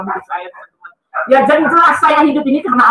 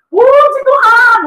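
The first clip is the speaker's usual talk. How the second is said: high, loud, long-drawn praise; joyful shout; 12 semitones higher, 5 dB louder, much slower than usual. Retold or imitated. Nurse praising God at the patient's recovery, joyful shout. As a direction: stretch it out like an amazed nurse crying out. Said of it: "katanya dia teriak".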